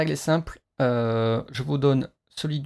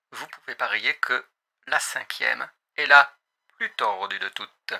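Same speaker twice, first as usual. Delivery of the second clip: pilot radio voice over headset; pilot cockpit headset. Acted. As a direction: speak like a cockpit announcement. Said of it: "la voix de pilote".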